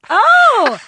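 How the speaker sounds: high, loud gasp of surprise; surprised. Real, not acted. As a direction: react with surprise. Surprised reaction.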